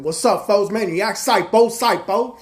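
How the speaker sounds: put-on high, loud drunk gang chant; gang kids' shout-out. Drunk gang kids greeting each other, loud rowdy chant. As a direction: hype it up like rowdy teens. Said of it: "All these kids were all like this".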